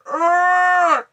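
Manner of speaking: a loud anguished ugh, pitched high; frustrated groan. Real, not acted. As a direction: let out a big nervous ugh. Nervous wait before trip launch.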